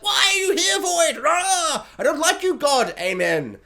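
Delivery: high, strained pretend screaming at the sky; hammed-up anguished yell; mock cowboy scream. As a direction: holler it high, hamming up a cowboy's rage. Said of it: "scream and be like".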